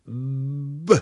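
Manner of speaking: B hummed with voice before the release; voiced b. Shown as a demonstration. Teacher showing a voiced consonant, hummed. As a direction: buzz the B through closed lips. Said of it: "si je fais un B".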